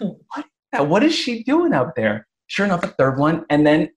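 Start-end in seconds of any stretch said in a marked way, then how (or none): 0.0-2.3 high, mock-puzzled wondering; imitating her own bafflement. Mom retelling hearing thumps upstairs, high and puzzled.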